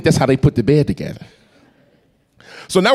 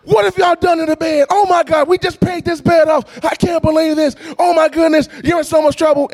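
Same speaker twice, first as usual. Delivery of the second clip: high-pitched, hammed-up scolding shout; mama in attack mode; 13 semitones higher than usual. Mother finding the broken bed, hammed-up angry yelling. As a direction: rant like an outraged mother bursting in. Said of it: "she is in attack mode".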